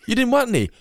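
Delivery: high, loud bickering; acting out the sponge cake argument; mock quarrel. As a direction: yell it like squabbling old folks.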